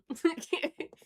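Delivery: giggle. Real, not acted. Laughing at wish for accent.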